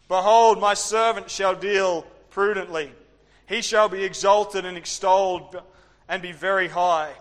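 loud, solemn reading of Isaiah; scripture reading. Acted. Preacher reading Isaiah to the congregation, loud and solemn. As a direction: declaim the verses with weight.

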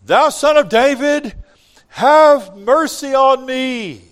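high, very loud plea, stretched at the end; crying out. Acted. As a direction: call out desperately to be heard over a crowd. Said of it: "began to cry out and say".

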